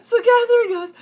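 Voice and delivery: loud, high, helpless cackling into speech; real laughing fit. Real, not acted. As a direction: laugh hard, then push on with the story. Long laughing fit mid-story.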